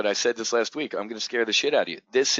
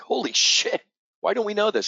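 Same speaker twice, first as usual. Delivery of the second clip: retold holy-shit reaction, pitched way up; shocked; 10 semitones higher than usual. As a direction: blurt it out in shock.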